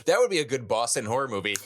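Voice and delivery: ordinary talk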